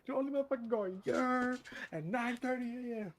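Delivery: high, loud mock cashier scolding; drive-thru worker. Cashier scolding a regular customer, high and loud. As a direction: yell at the customer like a fed-up cashier.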